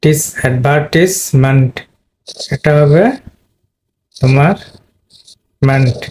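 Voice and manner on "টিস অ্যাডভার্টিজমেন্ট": slow stress on টিস in অ্যাডভার্টিজমেন্ট; slow "tis" syllable, then the whole অ্যাডভার্টিজমেন্ট; slow pronunciation demo. Shown as a demonstration. Teacher showing students the stressed syllable, slow careful demo. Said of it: "তার মানে টিস".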